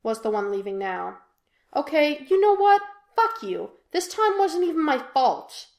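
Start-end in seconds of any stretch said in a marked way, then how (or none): 1.7-5.7 high, loud angry burst; angry outburst. A narrator's reading. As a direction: snap back in a rage. Jim lashing out in argument.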